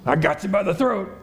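high, with a sneering laugh; bullying menace. Retold or imitated. Servant bullying his debtor, sneering high. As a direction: gloat over your grip on him. Servant's real intent voiced.

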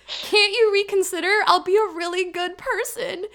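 high, pleading mock whine; imitating a drunken, pleading tantrum; childish tantrum. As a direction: beg like a child bargaining. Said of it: "like, a tantrum that my kids would pull".

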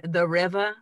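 "river" said as "rivah", no final R; dropped-R pronunciation. Acted. Co-host teasingly corrects "river", dropping the final R. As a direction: drop the last R in "river". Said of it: "there's no, uh, second R".

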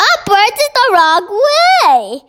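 high-pitched, scandalised protest; outraged cry. A narrator's reading. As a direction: exclaim in horrified disagreement. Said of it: "cried the B F G".

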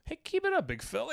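a crowd member talking down to the big guy, sweetly; patronising fan. Retold or imitated. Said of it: "and they're like".

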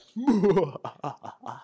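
loud, high villain cackle; maniacal laugh. Acted. Handler secretly releases his alligator, laughing maniacally. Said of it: "just maniacally laughs to himself".

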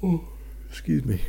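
mid-yawn apology; talking through a yawn. Real, not acted. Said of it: "Yawning while I'm podcasting".

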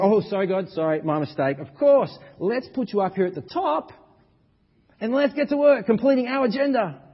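high, loud, hammed-up apology that shrugs it off; mock sheepish believer. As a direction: say sorry to God but keep your own plan. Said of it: "We say".

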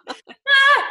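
high, loud shriek with a laugh; comic startled scream. Retold or imitated. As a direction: let out a sudden laughing shriek. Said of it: "I'm like".

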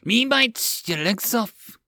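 mad goblin. Acted. Goblin threatening de John, high slow creature voice. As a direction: snarl it like a giddy little goblin. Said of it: "The goblin giggled madly".

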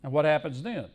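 normal talking voice

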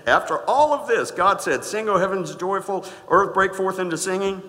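loud, high, ringing scripture proclamation; booming pulpit reading of the verse; preaching at full force. Acted. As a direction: proclaim it to the rafters.

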